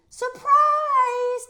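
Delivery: very high, loud shout; hollered surprise. Acted. Kids jump out shouting surprise at Junie B. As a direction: jump out and yell it. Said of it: "they hollered".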